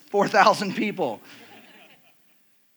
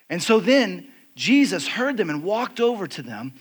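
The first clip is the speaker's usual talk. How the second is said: ordinary talk, nothing marked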